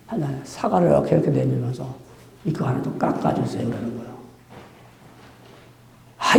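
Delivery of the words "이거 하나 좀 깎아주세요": 1 weak patient plea. Pretend. Cancer patient asking nurse softly.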